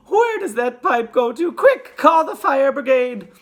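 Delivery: loud, high frantic yell; panicked mother raising the alarm; Missus Gloop. A narrator's reading. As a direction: shout urgently for help. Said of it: "yelled M-, Missus Gloop".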